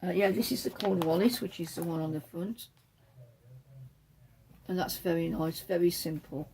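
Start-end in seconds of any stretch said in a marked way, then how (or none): none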